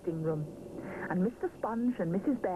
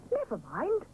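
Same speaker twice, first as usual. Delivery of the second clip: higher, businesslike; brisk; 7 semitones higher than usual. A narrator's reading. Missus Bear comforting Mister Sponge, brisk. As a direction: brush it aside. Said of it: "says Missus Bear briskly".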